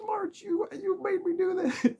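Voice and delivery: very high, whining mock-protest; whiny blame. Player whines at March that she caused it, very high voice. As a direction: whine it up high like a guilty kid.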